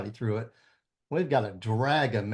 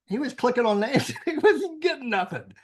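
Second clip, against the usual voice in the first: laughing; 10 semitones higher than usual. Real, not acted. Laughing while telling story.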